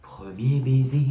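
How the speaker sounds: slow, drawn-out drag queen voice; drag queen host. Shown as a demonstration. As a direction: camp drag queen purr. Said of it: "ma voix de drag queen".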